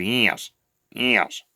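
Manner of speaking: flat, quiet, droning yes; monotone Alan. Retold or imitated. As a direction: say it like a man with nothing to add. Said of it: "He said it twice over".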